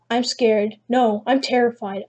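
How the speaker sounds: ordinary delivery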